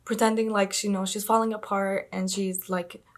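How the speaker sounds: normal talking voice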